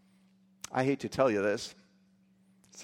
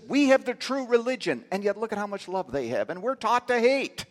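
distraught quote; 8 semitones higher, 4 dB louder than usual. Retold or imitated.

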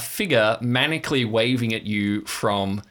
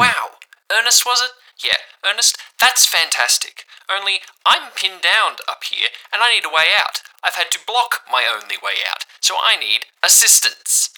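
sarcastic; 9 semitones higher, 6 dB louder than usual. Acted. Sarcastic Gina on radio.

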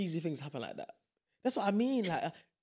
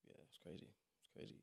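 whispered; 6 semitones lower, 24 dB quieter than usual. Real, not acted. Unvoiced aside.

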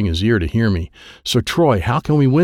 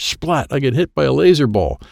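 plain reading voice

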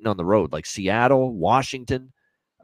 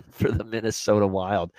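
ordinary delivery

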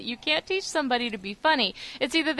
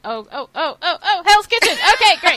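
mock script voice; 6 semitones higher, 10 dB louder than usual. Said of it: "my script just went".

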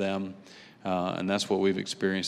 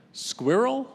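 British "Squirrel", two syllables, high questioning rise; British accent; 13 semitones higher than usual. Retold or imitated. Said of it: "say something with a British accent".

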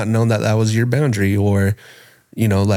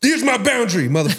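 hammed-up aggression, pitch way up; mock-confront them in a high voice; unkind confrontational voice; 12 semitones higher, faster than usual. Shown as a demonstration. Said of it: "stay in a neutral and kind voice".

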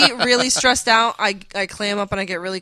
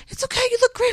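much higher, sweet reassurance; mock reassuring cousin; faster than usual. Bride imitating cousin calming her before the aisle, high coo. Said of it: "His cousin's looking at me like".

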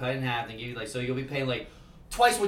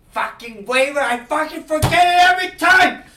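loud high frustrated yelling; furious shout; 15 dB louder than usual. Acted. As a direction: yell in fury at yourself. Forgot the waiver again.

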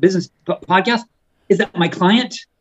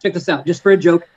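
ordinary delivery

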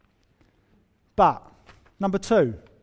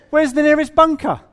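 joking punchline; 8 semitones higher, 7 dB louder than usual. Real, not acted. Joke about a golf bunker.

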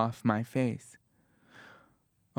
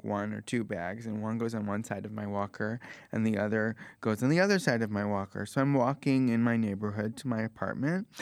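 ordinary talk, nothing marked

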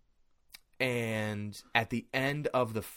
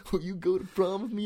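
mock prom ask; 8 semitones higher than usual.

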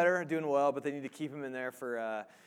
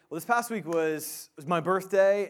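ordinary delivery

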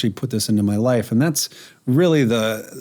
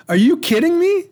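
high-pitched exasperated disbelief; incredulous; 4 dB louder than usual. Acted. Fireman faced with a squirrel emergency, incredulous. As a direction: throw it out high, in mock disbelief. Acted incredulous reaction to the lady.

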